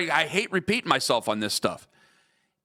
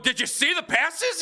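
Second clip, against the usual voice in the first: pitched way up, eager about the passes; excited retold question; 11 semitones higher than usual. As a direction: recreate how thrilled you were. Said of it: "I'm going".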